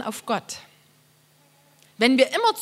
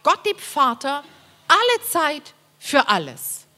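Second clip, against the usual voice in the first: slow scripture reading; 2 semitones higher, 4 dB louder, slower than usual. Acted. Da steht.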